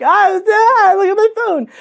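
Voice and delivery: very high, loud squeal; frantic excited shriek. Retold or imitated. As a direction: re-enact shrieking the news to a friend. Acts screaming at the good news.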